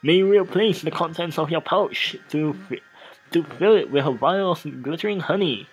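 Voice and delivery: reading the scene aloud, a touch theatrical; game text narration. Acted. Streamer reading game text aloud to viewers, lightly narrated. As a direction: narrate the erotic scene like a storyteller.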